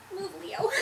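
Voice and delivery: ordinary delivery, nothing marked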